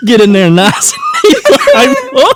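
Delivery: loud, high movie-trucker quote; hammed-up trucker voice, breaking into laughter; Joe Dirt trucker impression. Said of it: "That reminds me of that trucker dude".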